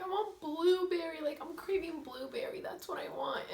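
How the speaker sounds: high-pitched, whiny mock pleading; tearful craving. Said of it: "And I start crying".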